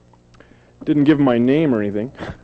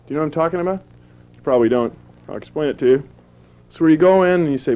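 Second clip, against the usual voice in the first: ordinary talk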